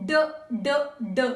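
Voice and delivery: short repeated "d" beats; phonetic D sound. Shown as a demonstration. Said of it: "keep making the sound of".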